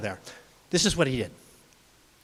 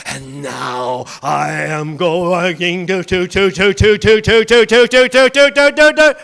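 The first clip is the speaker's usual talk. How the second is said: slow then louder, rising stutter; building threat; 9 dB louder, slower than usual. Acted. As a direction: build the menace step by step.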